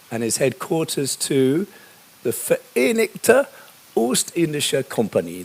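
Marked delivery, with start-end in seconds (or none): 2.2-5.4 terrible Dutch accent. Shown as a demonstration. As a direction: read the Dutch company name in a clumsy mock Dutch accent. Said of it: "That's my terrible Dutch accent".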